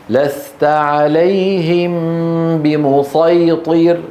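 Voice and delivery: slow measured chanting; slow recitation. Shown as a demonstration. Teacher reciting for students to repeat. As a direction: intone it slowly for the class to repeat. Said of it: "سأقرأ واقرأوا بعدي".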